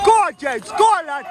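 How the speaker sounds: high shouted encouragement; cheering. Real, not acted. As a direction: yell to push the rider up. Cheering a rider on the climb.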